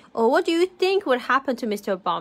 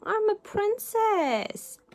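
high, slow, wailing princess protest; spluttered protest; 10 semitones higher, slower than usual. A narrator's reading. Princess objecting to the cold cellar, wailing splutter. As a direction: protest plaintively, stretched out high. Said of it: "Little Miss Princess spluttered".

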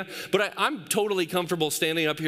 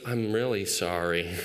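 low, slow mock apology; imitated sheepish son; 9 semitones lower than usual. Son standing next to the crying baby, imitated guilty apology. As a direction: mimic a guilty kid owning up slowly. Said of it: "And he goes".

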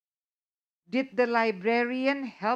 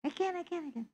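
high, soft repeated insisting; 8 semitones higher, 9 dB quieter, much faster than usual. Shown as a demonstration. Teacher acting out convincing a friend, pleading and insistent. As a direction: plead I can, I can, lifted and soft. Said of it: "you're trying to convince your friend".

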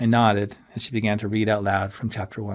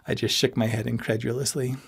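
ordinary delivery, nothing marked

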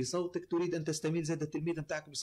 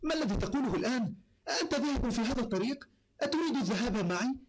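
higher, formal classical Arabic character line; acted character voice; 9 semitones higher than usual. Voice actor demoing a character for the host, performed. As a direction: play a story character addressing a traveller, raised pitch. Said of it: "ودراستك الشخصية مثلًا تاخذ كاركتير".

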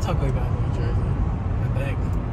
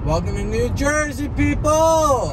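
excited greeting; 8 semitones higher, slower than usual. Pretend. Greeting the state while driving.

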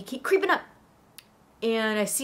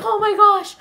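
higher, louder gasp of amazement; awed exclamation; 6 semitones higher, 7 dB louder than usual. Acted. Girl flying off in the sleigh, amazed. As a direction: exclaim it, breath taken away.

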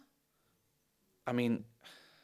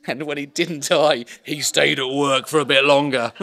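loud, laughing delivery, slower at first; laughing through it; 4 semitones higher, 10 dB louder than usual. Real, not acted. To the audience, laughing at the doctor's story, loud and laughing. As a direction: laugh the words out, can barely hold it in.

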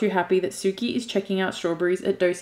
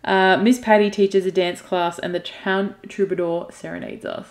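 ordinary talk

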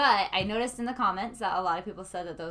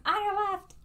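much higher, sudden called-out warning; mock heads-up call; 11 semitones higher than usual. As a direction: pipe it up high as if passing from behind. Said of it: "come up behind the person".

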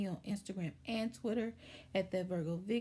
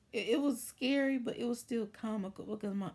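usual speaking voice

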